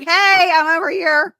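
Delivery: high, loud, slow mock wail from behind bars; hammed-up cry of a man calling out from his cell; mock wailing prisoner. Mocking the husband calling from prison, high loud wail.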